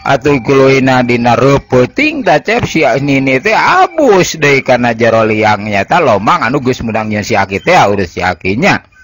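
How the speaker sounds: plain reading voice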